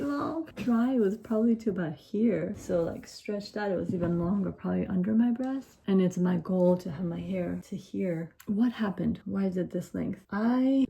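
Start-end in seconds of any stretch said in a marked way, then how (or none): none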